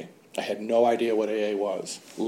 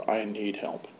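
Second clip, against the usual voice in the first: quiet; 3 semitones lower, 11 dB quieter than usual. Retold or imitated. First admission of needing help.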